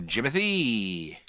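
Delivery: usual speaking voice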